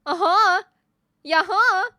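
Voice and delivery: put-on loud, childish back-and-forth contradiction; mock schoolboy bickering; boys arguing. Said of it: "Isn't that how boys argue? They just go".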